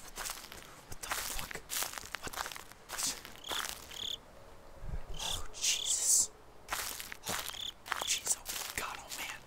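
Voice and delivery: barely audible, breathy oaths whispered; nervous whisper. Real, not acted. Player whispers to herself as the horror game starts, nervous whisper. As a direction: whisper it in dread under your breath.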